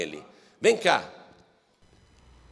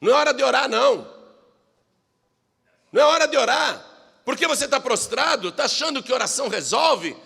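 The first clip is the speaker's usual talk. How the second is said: stern, raised voice; God rebuking; 8 semitones higher, faster than usual. Acted. God scolding Joshua lying face down, stern. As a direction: press him with questions. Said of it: "disse o Senhor a Josué".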